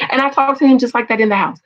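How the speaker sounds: ordinary talk, nothing marked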